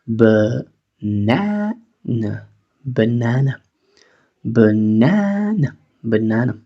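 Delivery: "banana" with the first and last a as schwa, buh-NA-nuh; reduced first and last a. Shown as a demonstration. Teacher drilling the word, reduced outer vowels. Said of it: "aquí reducimos la primera y la tercera".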